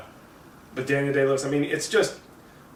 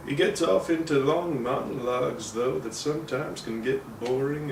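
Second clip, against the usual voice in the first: imitating Lincoln's voice; 4 semitones higher than usual. Shown as a demonstration. Reviewer showing how Lincoln talks in the film, lightly voiced. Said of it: "talking like this".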